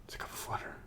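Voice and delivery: gasp, then a soft whisper; acted hushed amazement; whispered discovery. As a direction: whisper it like a secret find. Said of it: "It's like".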